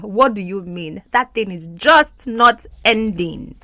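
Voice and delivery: ordinary talk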